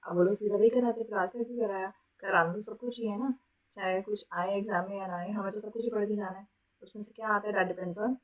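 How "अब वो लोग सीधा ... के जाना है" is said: usual speaking voice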